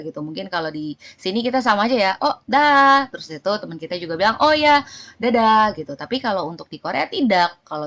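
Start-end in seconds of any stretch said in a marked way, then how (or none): 2.2-3.1 high, loud casual farewell; calling goodbye. Acted. Acted goodbye between friends. 4.4-5.8 high, loud friendly reply; calling goodbye back. Acted.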